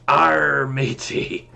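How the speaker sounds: loud, hammy pirate growl. Acted. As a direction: bellow it like an old pirate. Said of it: "We have entered Talk Like a Pirate Day".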